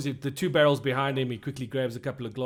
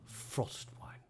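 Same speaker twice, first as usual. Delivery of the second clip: whispered repeat; 13 dB quieter than usual. Acted. Barmaid repeats the odd order.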